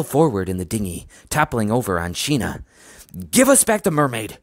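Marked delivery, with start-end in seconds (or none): 3.3-4.4 raised, insistent demand; high, commanding challenge; forceful demand. A narrator's reading. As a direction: demand it firmly, pitched well up. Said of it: "Doctor D demanded".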